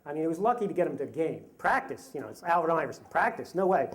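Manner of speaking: usual speaking voice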